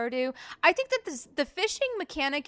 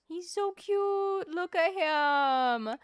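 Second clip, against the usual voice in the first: slow, higher cooing over a cute pet; 7 semitones higher, much slower than usual. Real, not acted. Streamer cooing slowly at her new pet. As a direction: melt over the little creature, slowly.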